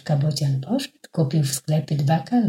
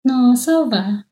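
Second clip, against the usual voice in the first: groaning; 7 semitones higher, 5 dB louder, slower than usual. Acted. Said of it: "jęknął bocian".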